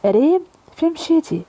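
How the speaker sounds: lightly voiced mother calling for her daughter. A narrator's reading. Mother looking for Reem at the party, mildly calling out. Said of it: "سمعت صوت أمها كتعيط".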